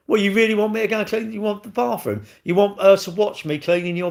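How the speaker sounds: incredulous. Real, not acted. Outraged at a chat suggestion.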